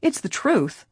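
high-pitched insistence; indignant. A narrator's reading. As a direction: protest it, affronted. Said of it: "George spoke up indignantly".

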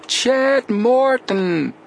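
very slow, high, loud yell; drawn-out yell. Acted. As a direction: stretch every syllable across the water. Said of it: "both boys yelled together".

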